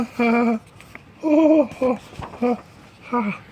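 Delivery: high, hammed-up burst of laughter; cracking up. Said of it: "Coach Armstrong had cracked up".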